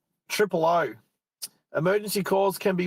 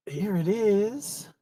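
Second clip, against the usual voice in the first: drawn-out slow; 3 semitones higher, much slower than usual. Real, not acted. Streamer pleased to find the item, slow drawn-out. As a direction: stretch each word out slowly with a lifting end. Found it, savouring it.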